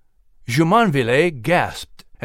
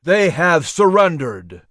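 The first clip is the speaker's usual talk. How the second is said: shouting; 3 semitones higher, 4 dB louder, slower than usual. Acted. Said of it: "Washington shouted".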